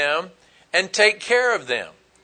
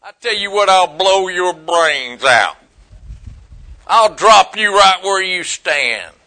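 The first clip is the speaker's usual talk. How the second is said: mock angry threat; 4 dB louder than usual. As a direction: act out someone losing their temper, stretching the words. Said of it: "'cause you was angry".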